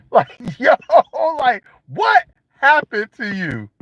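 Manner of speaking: ordinary talk, nothing marked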